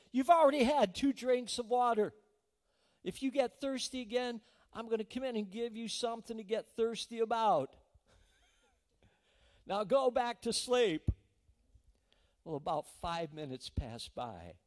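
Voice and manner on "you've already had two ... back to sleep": loud, higher, impatient snap; snapping father. Retold or imitated. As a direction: scold the child who keeps waking you, slowing on the last order. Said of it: "he kinda snaps and he said".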